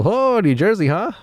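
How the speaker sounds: high, swooping feigned interest; forced crowd-work enthusiasm. Retold or imitated. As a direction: pitch it up, grasping for a response. Working the crowd while bombing.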